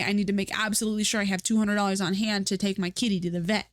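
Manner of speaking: ordinary talk, nothing marked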